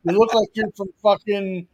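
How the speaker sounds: put-on joking Boston accent rant; mock Boston accent. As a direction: razz a guy in an exaggerated Boston accent. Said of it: "Boston accent".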